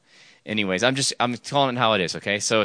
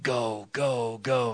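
hushed, urgent inner voice; soft, insistent prompting; inner voice urging; 6 dB quieter, much slower than usual. Retold or imitated. The Lord nudging the speaker to act, hushed. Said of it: "I heard this, like".

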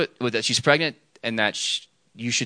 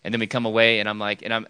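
usual speaking voice

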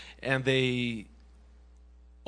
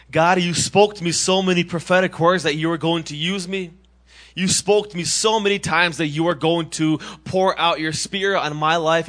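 raised, eager voice of a retold prayer; excited prayer; 3 semitones higher, 6 dB louder than usual. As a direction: lift it up with eager hope. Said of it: "and I was so excited".

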